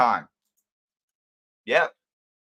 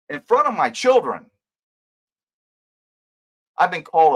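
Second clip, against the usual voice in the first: higher-pitched, forceful, angry rant; pissed off; 8 semitones higher, 4 dB louder than usual. Real, not acted. Streamer ranting to his viewers about being lied about, angry. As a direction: let real anger push the pitch up. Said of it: "I'm pretty pissed".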